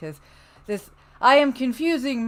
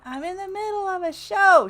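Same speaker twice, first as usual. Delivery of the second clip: high, loud mock retort; replaying the shout back at mom; retold own reply; 12 semitones higher, 6 dB louder than usual. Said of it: "I went".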